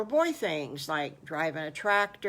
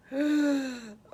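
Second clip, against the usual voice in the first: gasp; 7 semitones higher than usual. Acted. Excited gasp before report news.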